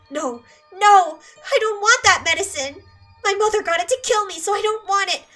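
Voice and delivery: loud, high panicked refusal; a girl screaming as she is held for a shot; hysterical screaming. Acted. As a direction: scream and fight off the doctor.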